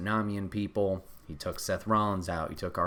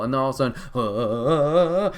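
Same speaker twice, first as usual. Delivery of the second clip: normal talking voice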